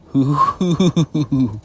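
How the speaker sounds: high, loud hoo-hoo chuckle; hooting chuckle. Acted. Deadpool recognizing where he is, hooting chuckle. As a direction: chuckle in high, rounded hoots. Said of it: "I chuckle and say".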